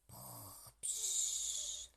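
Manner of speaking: snore, then puff out air; sleepy snore and sigh; snoring. A narrator's reading. Animals snoring.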